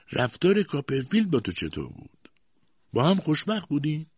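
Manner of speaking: high-pitched cold interrogation; cold, harsh. A narrator's reading. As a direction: question her harshly, without sympathy. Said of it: "با همان لحن سرد و خشن پرسید".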